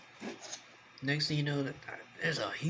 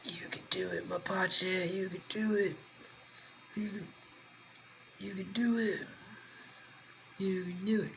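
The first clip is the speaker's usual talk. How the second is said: self cheer; 7 semitones higher, 3 dB quieter than usual. Real, not acted. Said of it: "cheering himself on".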